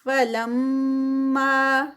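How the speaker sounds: فلما very slow, nasal hold on لم, ما two counts; ghunna and madd. Shown as a demonstration. Teacher reciting for a student. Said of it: "لم پہ غنہ".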